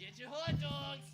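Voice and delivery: calling out hot dogs loudly; stadium vendor hawking. Acted. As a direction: hawk it like a ballpark vendor.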